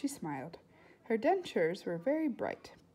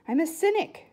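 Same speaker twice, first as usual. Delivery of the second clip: blurted much louder and higher; too loud; 8 semitones higher, 8 dB louder than usual. Acted. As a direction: announce it far too loudly. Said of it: "in a too loud voice".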